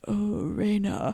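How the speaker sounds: lightly voiced creepy man; a hint of a creepy suitor purring her name; creepy male voice. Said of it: "creepy sounding Alexis being like".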